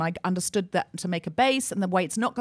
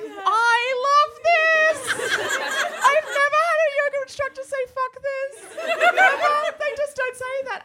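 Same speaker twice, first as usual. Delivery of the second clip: much higher, squealing with laughter; delighted; 5 dB louder, slower than usual. Retold or imitated. Recalling her thrilled reaction to the swearing teacher, high and laughing. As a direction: gush in giddy disbelief. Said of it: "And I was like".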